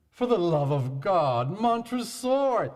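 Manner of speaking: pleading cry rising high; desperate plea. A narrator's reading. Walled-up Fortunato begging Montresor, high desperate cry. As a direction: beg for mercy.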